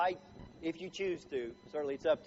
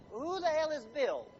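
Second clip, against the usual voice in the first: groggy grumble; 7 semitones higher than usual. Retold or imitated. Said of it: "roll over and go".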